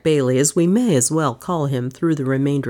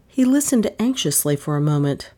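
ordinary delivery

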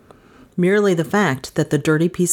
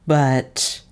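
ordinary delivery, nothing marked

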